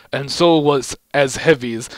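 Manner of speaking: usual speaking voice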